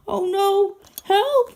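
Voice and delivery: high, loud panicked cry for help. Acted. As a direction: yelp in panic after the crash. After crashing.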